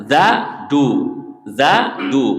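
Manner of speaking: Za with the tongue at the teeth against a plain du; za versus du. Shown as a demonstration. Teacher asking students which one is different, contrast drill. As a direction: alternate za and du so the difference shows. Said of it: "Beda mananya".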